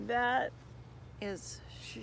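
ordinary delivery, nothing marked

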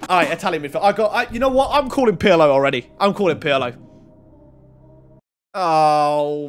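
usual speaking voice

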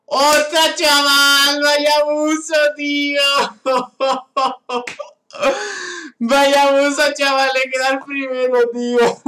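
loud, high excited shouting; can't believe the win, hype it up. Real, not acted. Streamer tells his viewers about the win, loud and thrilled.

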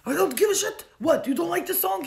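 very loud, high-pitched defiant shout; yelling. Retold or imitated. Suitor brushes off the other bar patrons, yelling. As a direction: imitate his outburst at full volume. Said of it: "He starts yelling".

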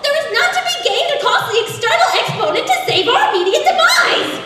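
high, loud plea building to a shriek; panicked pig. Acted. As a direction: rise in hammed-up terror to the last word. Pig pleads not to open.

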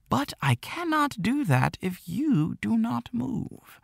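put-on old shopkeeper. A narrator's reading. Old shopkeeper prods Jack to move his chess piece, slow and teasing. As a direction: tease the boy gently about his chess move.

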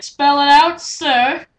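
high, loud and exaggerated; loud mock-polite taunt. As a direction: call it out as a mocking demand. Taunting the raging friend in chat.

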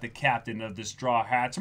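normal talking voice

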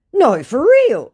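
high, pleading mock voice of the scammer. Reading the scammer's message aloud in a high mock voice.

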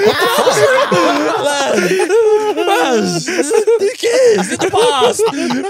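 high-pitched, laughing mock exasperation; laughing, strained high disbelief; incredulous retort. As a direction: squeal it through laughter at a rattled friend. Acting out exasperated reply to friend.

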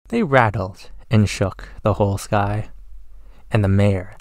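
ordinary delivery

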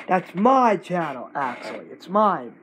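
ordinary talk

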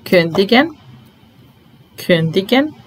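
normal talking voice

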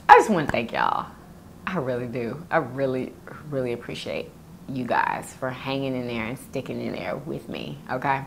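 soft, sincere thanks. Real, not acted. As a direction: say it softly from the heart.

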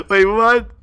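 laughing through a disbelieving wait, what; can't hold the laugh in; giggling disbelief. Real, not acted.

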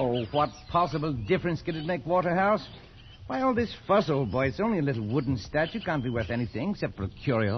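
high, airy scoffing; flippant dismissal. Acted. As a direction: brush off the fuss lightly. Flippant reply to furious friend.

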